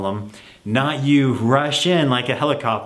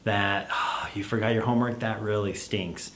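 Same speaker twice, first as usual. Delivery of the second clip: much softer, lower, sympathetic; empathetic; 3 semitones lower, 9 dB quieter than usual. Shown as a demonstration. Parent answering child who forgot homework, soft sympathy. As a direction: soothe the kid gently, full of sympathy. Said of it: "You wanna show some empathy".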